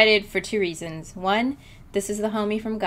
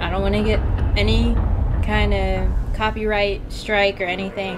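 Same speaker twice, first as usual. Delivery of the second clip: ordinary delivery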